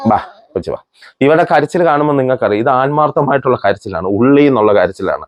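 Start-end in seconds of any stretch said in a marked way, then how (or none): 0.1-0.8 low, soft voice with a real cry in it; tearful. Real, not acted. Vlogger moved to tears calling the crying child over, low, soft and tearful. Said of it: "എനിക്ക് പോലും പറയുമ്പോ കണ്ണ് നിറയാ".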